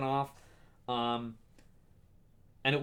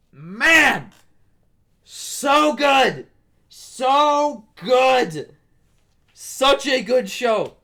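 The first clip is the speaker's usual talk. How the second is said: much higher and louder, crying out; moved to tears by the show; tearful cry of praise; overcome, near tears; 9 semitones higher, 10 dB louder, much slower than usual. Real, not acted. Emotional over the show.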